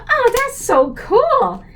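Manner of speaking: high, drawn-out gush; awed delight. Retold or imitated. Shown a cookbook by the lady, delighted gush.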